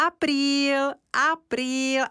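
high teasing shout of April fool; teasing call from afar. A narrator's reading. Týna calls from the yard to the children, teasing high call. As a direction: call out the tease from a distance. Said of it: "z dálky volá na děti".

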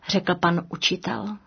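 plain reading voice

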